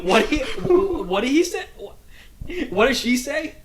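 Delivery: high, loud laughing protest; real laughter while asking. Real, not acted. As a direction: crack up mid-question. Laughing disbelief at the reply.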